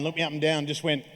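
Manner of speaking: usual speaking voice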